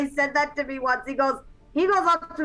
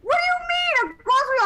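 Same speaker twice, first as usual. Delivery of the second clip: mock indignant; 12 semitones higher than usual. Real, not acted. Defending her own accent.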